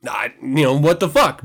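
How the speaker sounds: normal talking voice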